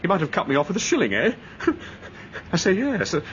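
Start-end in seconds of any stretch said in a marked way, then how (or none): 1.4-2.5 soft, breathy little laugh; uneasy laugh. Acted. Nephew joking about being cut off, soft laugh. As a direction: laugh it off quietly.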